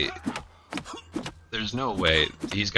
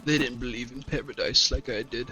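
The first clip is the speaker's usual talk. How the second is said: high-pitched picked-on dork; hammed-up high whining; mock whiny cultist; 7 semitones higher than usual. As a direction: make fun of the cultist in a high, pitiful voice.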